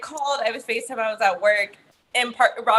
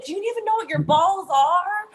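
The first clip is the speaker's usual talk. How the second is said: mock yelling mother; 7 semitones higher than usual. Mother retells yelling at her son, mild raised-pitch scolding. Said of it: "yelling at him".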